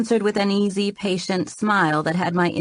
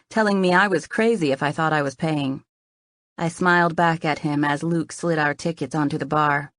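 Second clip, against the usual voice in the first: ordinary delivery, nothing marked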